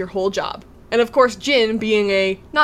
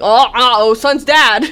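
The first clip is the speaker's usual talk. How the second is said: mock alarm; 6 dB louder than usual. Man learns Jin works for Sun's dad, mock alarm. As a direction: play sudden alarm at the name.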